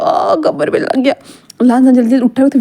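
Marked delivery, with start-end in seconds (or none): 0.0-1.1 slow and strained, back hurting; groaning about his back after falling off the bed; pained. A narrator's reading. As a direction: wince through each word after the fall. Fell off bed, hurt back.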